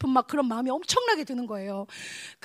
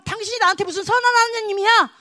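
high, shouted challenge to God; acted defiant accusation; 8 semitones higher, 8 dB louder than usual. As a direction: shout it as a bitter rebel's protest. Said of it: "무기력하다고 막 반항하고 있고".

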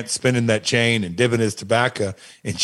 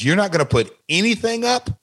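mock disbelief, pitched up; dad balking at the demands, incredulous and higher; 8 semitones higher than usual.

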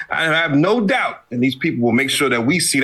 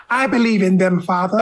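put-on higher-pitched British child's plea; little British boy; 4 semitones higher than usual. Co-host playing a pleading British boy to his father, British child voice. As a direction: put on a small British boy's voice. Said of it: "just like a little British boy".

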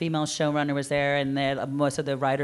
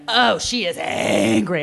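loud, slow, higher mocking exclamation; mock outraged reaction; 5 semitones higher, 6 dB louder, slower than usual. Panelist imitating how others reacted to her, mock outrage. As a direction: stretch it out in exaggerated alarm. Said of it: "I was treated".

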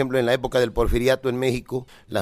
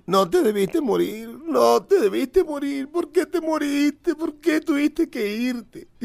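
high, slow, hammed-up sobbing lament; mock wailing; 12 semitones higher, slower than usual. Man crying over a grave, mock wailing. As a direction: wail it like a grieving widower, overdone. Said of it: "a grito batiente, a llanto tendido lloraba".

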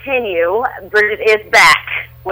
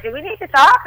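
mimicking worried family; 8 semitones higher than usual. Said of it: "I have family that's like".

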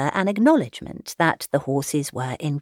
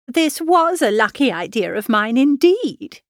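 high, pleased matchmaking mother; self-satisfied mother; 6 semitones higher than usual. A narrator's reading. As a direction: congratulate yourself in a high voice. Mother delighted with her scheme.

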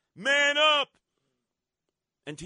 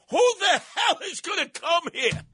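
outraged shout; 11 semitones higher than usual. Real, not acted. Radio host railing at listeners about Fear City, shouting.

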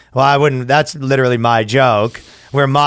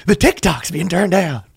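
higher, lightly voiced whiny influencer; quirky alter voice; 6 semitones higher than usual. Comedian voicing Cosmo whining about TikTok, lightly hammed-up. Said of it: "Cosmo could be causing some problems".

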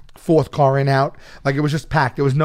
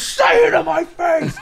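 furious shout; slower than usual. Retold or imitated. Wrestler screaming at the heckler in his face, furious yell. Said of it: "And he goes".

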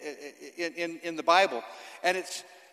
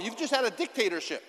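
normal talking voice

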